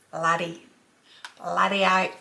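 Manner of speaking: BLOODY OATH in a broad Aussie way; Bloody with a flat 'blud' sound, not 'blood', then oath; Australian pronunciation. Shown as a demonstration. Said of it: "we enunciate it".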